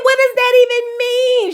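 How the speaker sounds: put-on high, puzzled girl's voice; a voiced young woman asking, baffled; confused girl. Girl's confused reply, retold.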